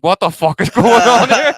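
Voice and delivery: high, loud and slow through laughter; laughing disbelief. Retold or imitated. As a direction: shout it in giggling disbelief. Retelling body moving on its own.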